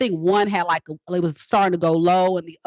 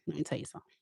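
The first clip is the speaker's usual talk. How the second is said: hushed aside; 5 semitones lower, 18 dB quieter, much faster than usual. Real, not acted. Intimate aside to viewers.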